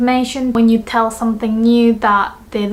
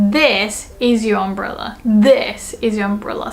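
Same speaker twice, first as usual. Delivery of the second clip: "This" leaned on, the rest lighter; teacher's emphatic "This"; stress on This. Shown as a demonstration. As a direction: put the weight on "This". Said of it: "put emphasis on the word this".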